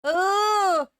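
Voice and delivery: high, loud startled yelp. Real, not acted. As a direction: caught off guard by the shout. Reacting to a shout on screen.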